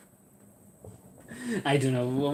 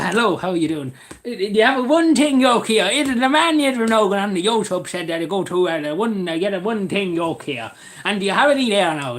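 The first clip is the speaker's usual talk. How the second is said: hammed-up Cavan shop customer; Cavan English; 4 semitones higher, 4 dB louder than usual. Customer quizzing the shopkeeper, hammed-up Cavan accent. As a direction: lay on a lively Cavan brogue. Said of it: "Cavan English".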